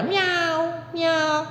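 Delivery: high, soft mock kitten meow; cat meow. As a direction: meow like a tame little kitten. Lions purring like cats in paintings.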